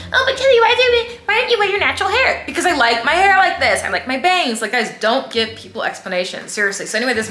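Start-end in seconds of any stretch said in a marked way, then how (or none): 0.1-2.4 much higher, louder put-on voice, quick at the end; mocking busybody. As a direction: squeal it like a prying busybody. Mocking people who question her.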